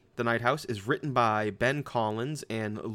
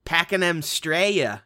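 put-on Australian accent; 6 semitones higher, 7 dB louder, much slower than usual. Shout out in Australian voice.

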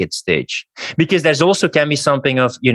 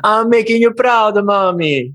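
mock proud child; 9 semitones higher than usual. Guest plays a son boasting to mommy, high and childish. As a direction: chirp it like a proud kid.

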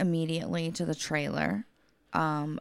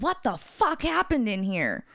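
higher-pitched mock yell; retold scream; 6 semitones higher, 4 dB louder than usual. Caregiver finding the client on the floor, retold scream. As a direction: yell it in alarm, toned down for retelling. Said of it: "I scream hella loud".

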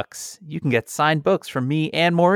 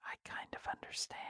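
whisper; 23 dB quieter, faster than usual. Real, not acted. Dry whispered aside to cohost.

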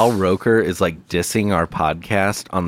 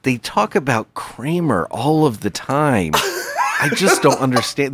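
higher, laughing mock bafflement; mock TV host; 6 semitones higher than usual. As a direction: play a puzzled morning host sneering at the show. Continues mocking the TV hosts.

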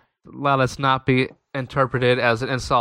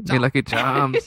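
slow, hammed-up Irish brogue; fake Irish accent; slower than usual. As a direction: put on a cartoonish Irish lilt. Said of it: "Is he Irish or is he Scottish?".

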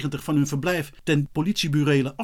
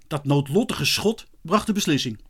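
ordinary delivery, nothing marked

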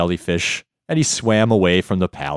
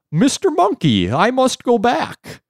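high, wavering jellyfish voice; wobbly floating jellyfish; 11 semitones higher than usual. Acted. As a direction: coax him in a lilting warble. Jellyfish coaxing in story.

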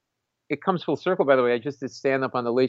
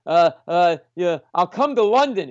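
flustered; 6 semitones higher, 3 dB louder than usual. Retold or imitated. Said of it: "I was just, like, completely".

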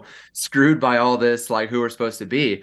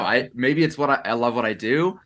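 ordinary talk, nothing marked

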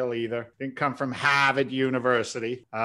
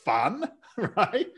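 laughing; 8 semitones higher, 4 dB louder, slower than usual. Retold or imitated. Sarcastic thought, laughing.

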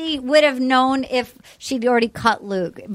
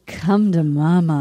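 seductive; 8 semitones lower, slower than usual. Retold or imitated. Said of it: "And then she goes".

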